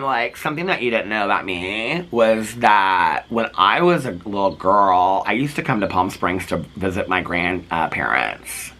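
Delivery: low, slow put-on voice; imitating tipsy Meredith. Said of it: "they've been drinking sixteen, uh, espresso martinis".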